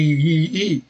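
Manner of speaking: "I" said as two drawn-out ee sounds; "I, I" as a long, repeated ee; doubled vowel. Shown as a demonstration. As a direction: show the wrong double "I", stretched out slowly. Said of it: "il faut pas dire".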